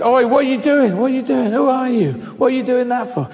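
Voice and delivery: high, pestering schoolgirl heckle; jeering teenage girls. Retold or imitated. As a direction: fire nosy questions like a teenage bully.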